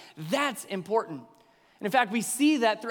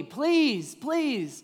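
mild begging; 5 semitones higher than usual. Acted. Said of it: "And he was begging".

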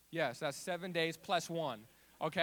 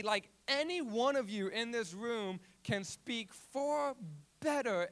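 loud, higher-pitched protest; yelled refusal; 5 semitones higher, 7 dB louder than usual. Retold or imitated. Teen refusing the staring youth group, yelled protest. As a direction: yell it out in alarmed refusal. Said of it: "I yell out".

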